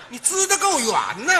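ordinary talk, nothing marked

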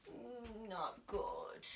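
sad. Acted. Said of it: "It's a sad mask".

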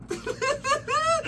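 squeaky breathless giggle; a high helpless laugh; high squealing laugh. Real, not acted.

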